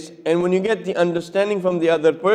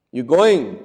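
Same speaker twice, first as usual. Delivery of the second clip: curt demand, punched on GOING and dropped; confrontational, falling "going"; curt, accusing tone; 2 semitones lower, 4 dB louder than usual. Shown as a demonstration. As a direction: challenge them with "going", falling hard. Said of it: "how is my tone of voice".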